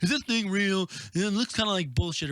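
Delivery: mock frat boy. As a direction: sneer it like a douchebag frat boy. Mocking frat boys at the exhibit.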